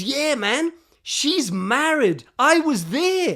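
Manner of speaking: high, loud retold insistence; emphatic insistence. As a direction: push it out as undeniable proof. Friend insists she is married.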